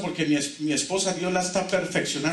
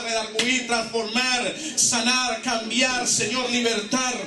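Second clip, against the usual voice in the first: raised, ringing preacher's voice; fervent preaching; 5 semitones higher than usual. Acted. Pastor leading the church in repeat-after-me declarations, fervent and raised. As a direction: lead the congregation in bold declarations.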